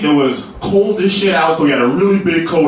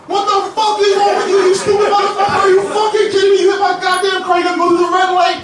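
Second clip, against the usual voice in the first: furious rant in one breath. Retold or imitated. Driver to injured courier, shrieking high tirade. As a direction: scream it all out in one breath. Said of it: "All in one breath".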